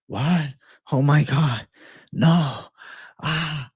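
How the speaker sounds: mock panicked gasps, loud; imitating panic. Retelling friend's bad trip, mock panic. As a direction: act out a friend freaking out on shrooms.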